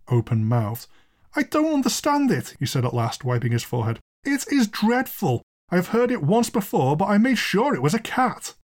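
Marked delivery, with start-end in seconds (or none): 1.4-2.6 high-pitched, bewildered protest; distressed landlord. Acted. Shaken landlord reacts to horrible sound. 4.2-8.5 high, appalled confession; horrified landlord. Acted.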